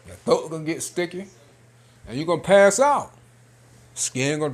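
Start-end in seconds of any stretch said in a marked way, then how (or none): 2.1-3.1 emphatic. Real, not acted. Warning rises loud.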